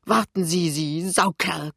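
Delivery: strained, panted insult, drawn out at the end; panting. A narrator's reading. Pinneberg wrestling the colleague, panting with rage. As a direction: wheeze it out mid-scuffle. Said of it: "keucht Pinneberg".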